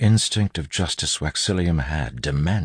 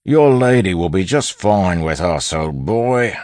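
loud, raised bandit voice with a smile; gloating bandit; 6 semitones higher, 7 dB louder than usual. A narrator's reading. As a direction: taunt through a smile. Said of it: "said with a smile".

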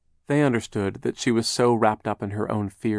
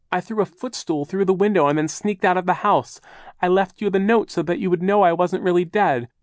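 put-on high, louder nervous old aunt; nervous aunt voice, higher and louder; high fussy aunt; 9 semitones higher, 5 dB louder than usual. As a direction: fret like a timid aunt explaining. Aunt voice continues.

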